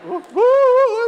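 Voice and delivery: high, loud shaky whoops; shaking with excitement; shaken. Retold or imitated. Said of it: "I'm shaking".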